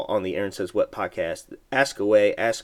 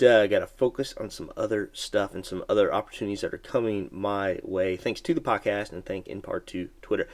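usual speaking voice

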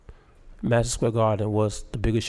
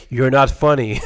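laughing; 7 dB louder than usual. Retold or imitated. Reading a note mid-bombing, laughing.

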